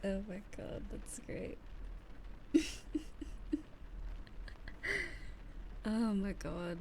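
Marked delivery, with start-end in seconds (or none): 1.6-5.8 teary high laugh; real laugh-cry. Real, not acted. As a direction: laugh until almost crying. Laughing near tears.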